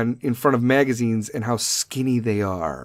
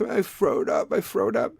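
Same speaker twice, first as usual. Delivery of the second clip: put-on babyish lisp; 6 semitones higher, slower than usual. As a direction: whimper it like a sick little kid. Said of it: "with their frothy ass mouth".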